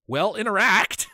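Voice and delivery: loud, high, drawn-out mock exasperation; exasperated prodding. Game master prods silent novice players, exasperated cry. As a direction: burst out as if patience has run out.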